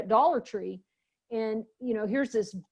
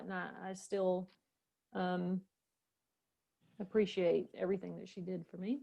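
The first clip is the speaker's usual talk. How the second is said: softer, fighting back tears; real gratitude welling up, much softer; real emotion; 4 semitones lower, 9 dB quieter than usual. Real, not acted. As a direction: voice dropping as feelings rise. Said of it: "I'll get emotional right now".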